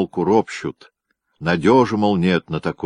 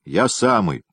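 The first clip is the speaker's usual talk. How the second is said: proud; 10 semitones higher than usual. A narrator's reading. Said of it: "гордо произнёс парень".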